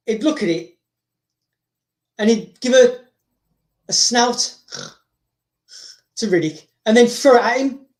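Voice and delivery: ordinary talk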